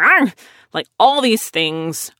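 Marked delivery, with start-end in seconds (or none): 0.0-0.3 short playful growl like a guard dog; mock dog growl. Imagined dog growling at intruder.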